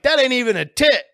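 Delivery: high, loud mock protest; worked-up comic disbelief; retold arguing. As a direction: object loudly like a heated buddy debate. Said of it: "we're arguing".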